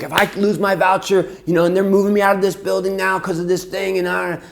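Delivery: imitated angry mom, loud and high; furious yelling. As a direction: yell it like a fuming mother. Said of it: "she's cursing and yelling at us".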